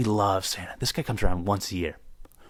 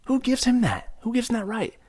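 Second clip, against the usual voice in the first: indignant outburst; 13 semitones higher than usual. Real, not acted. Indignant rant about Santa.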